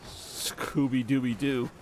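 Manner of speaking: slow, drawn-out cartoon dog voice; Scooby-Doo voice. Streamer slowly playing Scooby-Doo for chat's request. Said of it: "If you want me to be Scooby-Doo".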